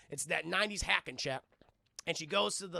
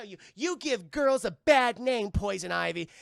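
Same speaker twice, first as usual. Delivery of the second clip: hammed-up heroic confrontation; heroine's rebuke; 8 dB louder than usual. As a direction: declare it loudly at the villain.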